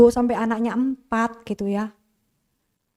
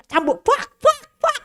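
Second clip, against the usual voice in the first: high, louder "buak, buak" like a belt lash; whip sound effect; 13 semitones higher, 4 dB louder, slower than usual. Retold or imitated. Father whipping her with belt. (menirukan suara cambuk, keras dan tinggi)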